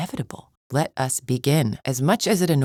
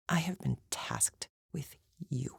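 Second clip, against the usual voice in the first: much softer, near-whispered disdain; hushed disgust; 13 dB quieter than usual. Acted. Champion tasked with a despised mercenary, hushed disgust. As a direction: drop to a loathing near-whisper. Disgusted at being given this task.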